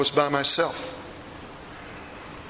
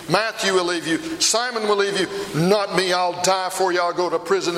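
raised, urgent dramatic retelling; impassioned preaching; 4 semitones higher than usual. Acted. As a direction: drive it up with mounting anguish. Peter's anguished climax of the monologue.

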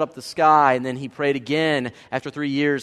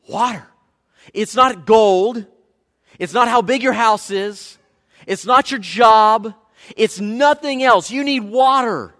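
loud, higher, punched phrases with pauses; emphatic preaching; 5 semitones higher, 7 dB louder than usual. Real, not acted. Preacher telling congregation what drought demands, forceful and emphatic. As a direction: hammer each item home.

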